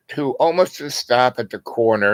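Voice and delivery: usual speaking voice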